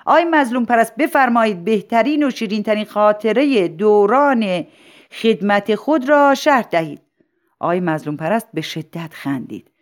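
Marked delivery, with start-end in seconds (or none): 0.1-7.0 principal's raised, projected voice; loud announcement. Acted. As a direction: address the hall loudly and formally. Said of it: "مدیر مدرسه با صدای بلند گفت". (با صدای بلند و رسمی)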